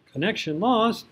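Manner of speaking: high, loud robotic announcement of "Connection lost"; mock computer alert. Mouse disconnects mid-lesson.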